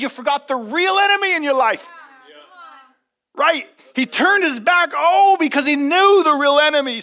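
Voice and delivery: loud, high preacher's cry; full-force preacher climax; preaching at full force. Acted. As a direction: drive it home to the church.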